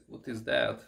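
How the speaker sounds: ordinary talk, nothing marked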